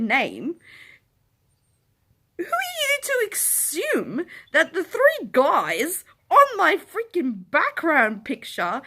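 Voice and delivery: loud, high-pitched outraged tirade; worked-up, incredulous storytime rant; indignant rant. Retold or imitated. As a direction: tell them off at the top of your voice. Ranting retold comeback at presumptuous guest.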